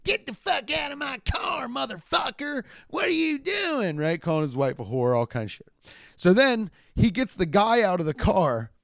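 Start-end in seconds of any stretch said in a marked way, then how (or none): 0.0-4.0 very high, strained mock shout; crazy voice. Hillbilly ordering a man from his car, crazy high shout. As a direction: squeal the order in a wild voice. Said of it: "his voice is crazy".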